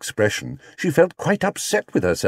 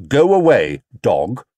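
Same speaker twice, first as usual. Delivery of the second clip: louder, stern bear voice; stern command; 5 dB louder, slower than usual. Acted. Paddington warning off the dog, sternly. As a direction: order the dog off. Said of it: "he said sternly".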